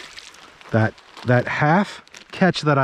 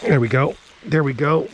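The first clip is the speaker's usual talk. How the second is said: usual speaking voice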